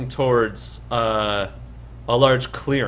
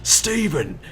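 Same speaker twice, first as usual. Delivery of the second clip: mock yell, pitched high but held back; 6 semitones higher than usual. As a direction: call out for a lost brother, half-voiced shout. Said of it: "He starts to yell out".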